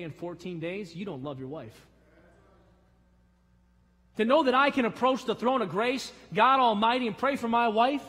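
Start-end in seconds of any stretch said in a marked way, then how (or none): 4.2-8.0 loud, high preaching, voice lifted; full-force preaching. Acted. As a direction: lift it up to the throne like a pulpit cry.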